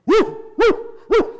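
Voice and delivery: high, loud barking woof; dog barking. Acted. Cartoon dog barking before the collar, high and loud. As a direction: bark it out like a dog. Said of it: "The dog's going".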